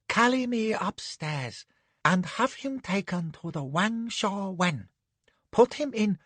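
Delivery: soft, idle lady's commands; languid orders. Acted. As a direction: give orders lazily from the chair. Madam gives orders.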